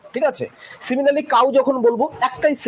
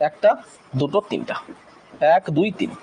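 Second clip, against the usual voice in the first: ordinary talk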